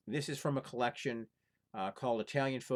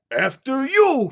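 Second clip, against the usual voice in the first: much higher, loud thundering voice; the giant thunders his insistence; 17 dB louder, much slower than usual. Acted. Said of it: "thundered the giant".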